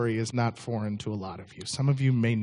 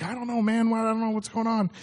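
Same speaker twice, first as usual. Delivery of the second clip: bewildered; 9 semitones higher than usual. Retold or imitated. Bewildered reply to panicked friend.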